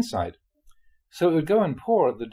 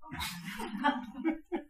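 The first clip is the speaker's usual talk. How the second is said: breathy laugh; 10 dB quieter than usual. Real, not acted. Laughs after the snot joke.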